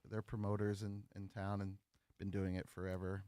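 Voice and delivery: normal talking voice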